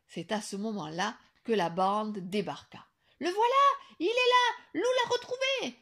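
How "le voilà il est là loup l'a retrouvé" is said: high, loud shout of joy; wildly happy high shout; joyful yell. A narrator's reading. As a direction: call out overjoyed. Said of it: "hurla demoiselle Yéti, folle de joie".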